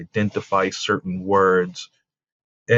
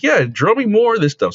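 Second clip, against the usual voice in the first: excited encouragement; 12 semitones higher, 5 dB louder than usual. Retold or imitated. Dad encouraging son to draw.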